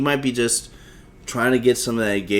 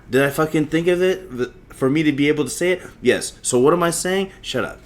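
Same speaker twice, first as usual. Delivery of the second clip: normal talking voice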